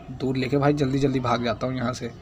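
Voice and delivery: usual speaking voice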